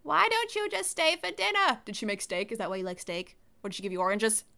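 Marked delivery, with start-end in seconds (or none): 0.0-1.8 put-on old lady voice.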